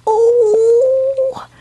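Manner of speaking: very high loud oh; real delighted squeal; excited squeal. Real, not acted.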